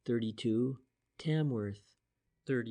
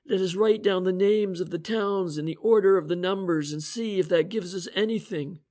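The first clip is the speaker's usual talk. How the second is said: higher, louder voiced inspector, eager reasoning; Inspector French voice; 7 semitones higher, 6 dB louder than usual. The inspector explaining the cipher to Price and Shane, eager. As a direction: lay out the theory with rising energy.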